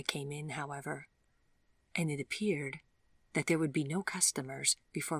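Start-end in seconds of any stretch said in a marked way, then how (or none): none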